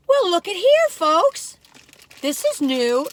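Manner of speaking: high, loud look-at-this; excited discovery. Real, not acted. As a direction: call the folks over, thrilled. Excitedly showing a new find.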